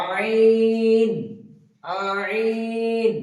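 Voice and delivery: আইন loud from mid-throat, the second drawn out; Arabic letter ain from the throat. Shown as a demonstration. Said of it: "বলুন আমার সঙ্গে সঙ্গে আপনারা বলুন". (কণ্ঠনালীর মাঝখান থেকে জোরে আইন)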